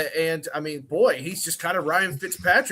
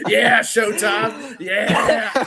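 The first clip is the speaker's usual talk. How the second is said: hype shout; 6 semitones higher, 8 dB louder, much slower than usual. Real, not acted. Hype shout at stream start.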